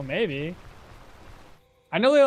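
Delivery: ordinary talk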